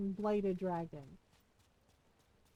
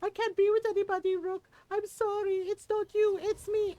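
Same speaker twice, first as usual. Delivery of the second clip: higher, louder, fast mock whine; higher mock-dramatic sulk; mocking whiny excuse; 7 semitones higher, 5 dB louder than usual. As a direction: parody the ex's lame breakup line.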